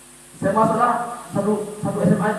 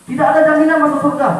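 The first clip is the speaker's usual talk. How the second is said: louder emphatic plea; 5 semitones higher, 7 dB louder than usual. Retold or imitated. Repeating crying parent's message.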